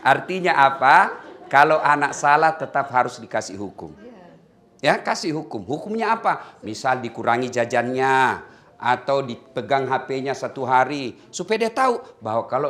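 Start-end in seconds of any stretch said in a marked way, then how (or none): none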